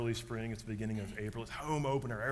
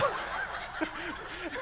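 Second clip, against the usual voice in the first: big laugh. Retold or imitated. Dad laughing after yelling baseball at the flyover.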